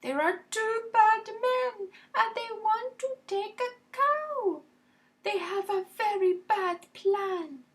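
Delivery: high, fast little bug voice; tiny ladybird voice. Acted. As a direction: squeak it out like a worried ladybird. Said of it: "The ladybird tells the animals".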